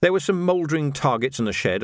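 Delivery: ordinary delivery, nothing marked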